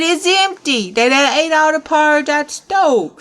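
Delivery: high, loud indignant exclamation; outraged discovery. Acted. Papa Bear finds the stove's pot empty, high loud outrage. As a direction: pitch it up in disbelief.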